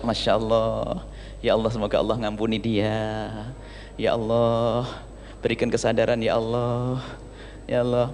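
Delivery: gentle murmured plea to God; soft forgiving prayer. Retold or imitated. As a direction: pray quietly for the one who hurt you. Gentle wife prays for wrongdoer.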